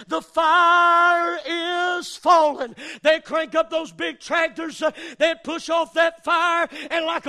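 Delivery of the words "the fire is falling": slow, far-carrying call from the hilltop, louder. Retold or imitated. Worker on the hill answering the crowd, slow loud call. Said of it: "a voice from on top of that hill would call out".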